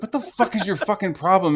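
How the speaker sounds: high-pitched angry confrontation; retold exasperated outburst; losing it. Man confronting the screenshot leaker in the room, losing it. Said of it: "fucking lost my shit".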